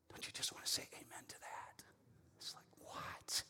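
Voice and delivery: very soft, breath-only whisper; awed whisper. Real, not acted. Preacher marvelling with church at God's gift, awed whisper. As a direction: whisper it as if stunned.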